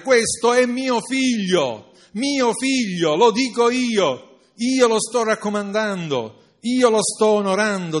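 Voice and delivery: God booming his approval; God declaring. Acted. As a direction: declare it with full divine authority. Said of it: "disse Dio".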